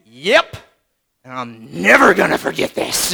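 low, curt threat; a stuffer promising never to forget, low and curt; grudging yep. Retold or imitated. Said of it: "It's like".

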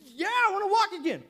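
loud, high, eager answer; acted high-pitched outburst; emphatic acted reply. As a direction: shout back an emphatic yes. Man answers Jesus in acted exchange.

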